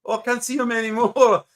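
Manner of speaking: high mock Canadian voice; lightly voiced Canadian squinting after his dog; Canadian accent. Joining the bit about the dog owner, high Canadian accent.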